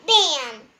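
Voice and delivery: loud punchy bam; sound effect bam. A narrator's reading. Narrator voicing the brick wall impact, punchy.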